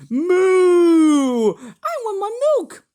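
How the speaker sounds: high, loud, wailing moo of a hungry cow; crying cow. Acted. Toy cow wailing to the vlogger for milk, high crying voice. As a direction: bawl like a calf that wants its milk.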